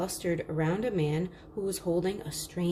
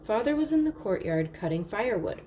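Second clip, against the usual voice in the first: ordinary delivery, nothing marked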